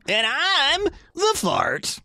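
high, slow goofy DJ voice; hammy radio announcer; radio host voice. Pretend. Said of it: "Yeah, it's terrible".

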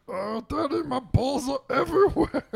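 high, silly puppy voice; squeaky little dog whining. Retold or imitated. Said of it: "Bowie's like".

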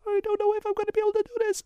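very high, fast squeaky cartoon mouse; Mickey Mouse voice. Shown as a demonstration. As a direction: make the worry sound ridiculous in a cartoon mouse voice. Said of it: "a Mickey Mouse voice where you go".

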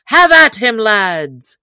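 high, loud battle call; rallying shout. A narrator's reading. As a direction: shout the order to charge. Will rallies men to attack.

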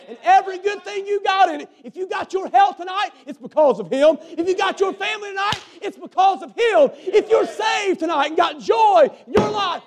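high, loud preacher's shout; full-force preaching. Real, not acted. Preacher crying out his own testimony to the church.

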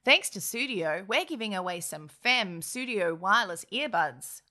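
ordinary delivery

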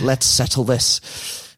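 slow, muddled attempt at Danish-Mancunian; botched Danish-Manc accent. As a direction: fumble a half-Danish, half-Manc accent, drawn out. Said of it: "Don't know what that accent was".

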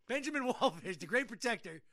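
ordinary talk, nothing marked

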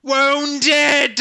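high, loud dying gasp; having a heart attack. Pretend. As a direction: ham up a mortal wound. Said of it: "I'm gonna fall on the ground and clutch my chest".

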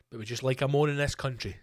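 ordinary talk

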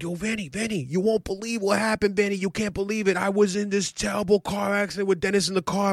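breathless uncle rushing his story; imitating his uncle. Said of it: "He goes like".

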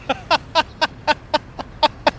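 high, breathy wheezing laugh. Real, not acted. Teller laughing at the cop's ugly joke, wheezy laugh. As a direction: laugh in a run of short high bursts.

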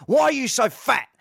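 mock shout, high and loud; retold shout. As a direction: yell it like a cricketer's taunt. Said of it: "he shouts at".

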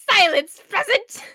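loud haughty royal command; mock royal decree. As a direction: bark it like a mocking king. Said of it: "you've said this somewhere before".

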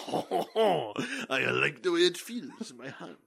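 slow, dreamy little-critter voice; a cute raccoon voice, savoring it. Retold or imitated. Voicing the raccoon again.

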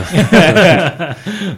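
laughing through the question; giggling while teasing. Real, not acted. Teasing joke about a Christmas party.

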